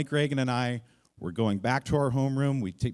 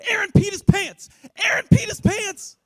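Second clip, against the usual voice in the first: very high, loud mock scream; 8 dB louder than usual. Class clown announcing the accident to everyone, screaming. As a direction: yell it down the hall like a tattling kid. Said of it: "he starts screaming at the top of his lungs".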